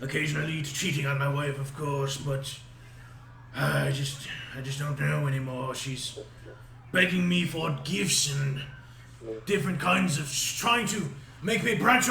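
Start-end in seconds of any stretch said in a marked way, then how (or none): none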